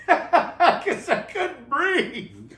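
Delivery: loud, high, slow words through laughter; laughing. Real, not acted. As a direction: squeeze it out while laughing hard. Laughing after re-enacted shout.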